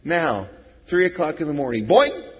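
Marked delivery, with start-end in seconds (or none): 1.9-2.3 sudden high loud boing. Retold or imitated. Pastor mimicking waking up with a loud boing. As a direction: pop awake with a cartoon boing.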